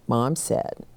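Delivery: ordinary delivery, nothing marked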